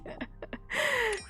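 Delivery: high breathy burst of giggles; real giggle, tickled by the story; giggling. Real, not acted. Laughing at friend's retold frustration.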